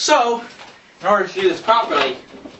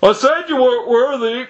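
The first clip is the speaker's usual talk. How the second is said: dramatic shout; 7 semitones higher, 4 dB louder than usual. Pretend.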